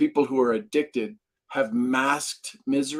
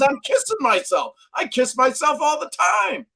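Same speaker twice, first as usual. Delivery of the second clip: gleeful shout; 12 semitones higher than usual. Retold or imitated. Mentor explaining he kisses himself, playful shout. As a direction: shout it with glee. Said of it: "He says".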